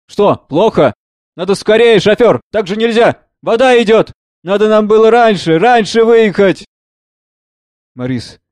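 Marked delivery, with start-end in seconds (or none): 0.1-6.7 urgent, worried calls to leave; anxious doctor. Acted. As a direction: hurry them, fearing the water. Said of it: "Доктор беспокойством". (тревожно и торопливо)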